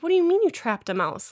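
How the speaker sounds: retold skeptical query, voice lifting. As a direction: question it in high-pitched disbelief. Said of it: "He sounds skeptical".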